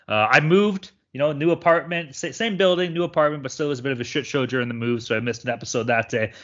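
usual speaking voice

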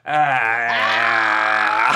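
long low mock yell; imitated drawn-out "ah"; retold cry. Comic retelling Lunel at the van door, long mock yell. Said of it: "Lunel goes".

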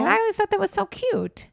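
very high, nasally cooing; Mickey Mouse voice. Real, not acted. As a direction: gush in a squeaky-high doting voice. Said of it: "What's with the Mickey Mouse voice over there?".

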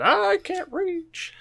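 very high, slow squeaky dinosaur; tiny T-Rex voice. Retold or imitated. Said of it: "That's what the alligator got".

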